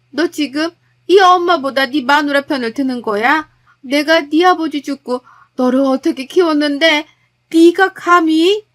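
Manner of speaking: acted outrage ending in a slow, loud "감히"; mother lashing out at her son; furious mother. Mother scolds son for siding wife. (아들을 꾸짖는 시어머니의 분노)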